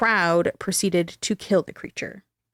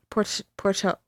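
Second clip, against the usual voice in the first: normal talking voice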